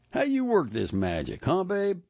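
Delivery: a hint of a slick old flame; Jerome. A narrator's reading. Customer teasing the barber about his hair, lightly voiced.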